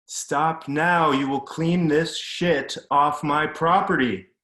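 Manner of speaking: loud, high, slow commanding shout; furious yell. Retold or imitated. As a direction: drill each word into him like an order. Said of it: "Dad kept yelling at the kid".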